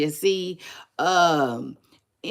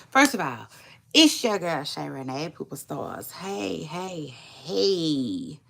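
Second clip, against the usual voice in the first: ordinary talk, nothing marked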